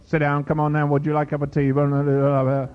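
monotone patter on one note; mock preacher chanting small talk at home; put-on preacher voice. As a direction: drone it like an auctioneer preacher. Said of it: "they put on this voice".

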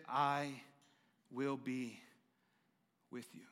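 tender divine whisper; fading to a whisper. Acted. God's promise, fading to whisper.

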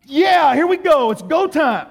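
loud, high burst of zeal; eager evangelist. Retold or imitated. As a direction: jump in pumped, raring to go.

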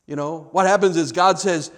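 usual speaking voice

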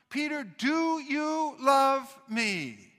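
slow and high, each word stretched out; insistent, weighty question. Acted. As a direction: press every word of "do you love me" slowly. Acts Jesus questioning Peter insistently.

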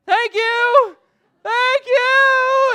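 very high, very loud mock wail; hammed-up shouted thanks. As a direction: call gratitude after someone walking away, comically overdone. Mother still calling thanks after him.

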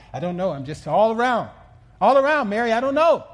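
rising to a high, loud cry; worked-up confusion. Retold or imitated. Preacher reliving his free fall for the congregation, frantic confusion.

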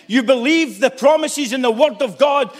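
ordinary delivery

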